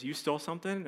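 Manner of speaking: hushed, disbelieving question; shocked mom. Retold or imitated. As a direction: imitate mom asking softly in disbelief. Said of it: "that look on my mom's face".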